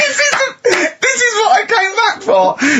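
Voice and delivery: high, loud laughter-cracked shout; laughing shout. Real, not acted. Mocking Carl's drivel to listeners, shouting through laughter. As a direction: shout it out while still laughing hard.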